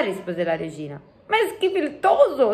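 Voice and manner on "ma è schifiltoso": high-pitched giant in revulsion; disgusted exclamation. A narrator's reading. Giant complaining about coffee, high disgusted exclamation. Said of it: "esclamò il Gigi".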